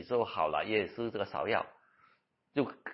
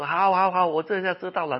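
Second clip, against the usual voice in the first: hasty contrite agreement; 7 semitones higher, 5 dB louder than usual. Retold or imitated. Patient hastily promises to repent.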